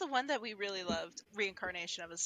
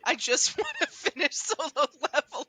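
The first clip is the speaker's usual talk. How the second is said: laughing through the words, higher and louder; 6 semitones higher, 7 dB louder than usual. Real, not acted. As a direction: laugh while whining about waiting. Longing to finish the show.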